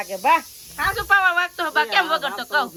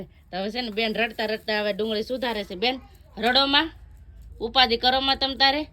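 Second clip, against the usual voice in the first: ordinary talk, nothing marked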